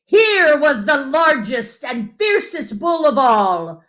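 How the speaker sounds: ordinary delivery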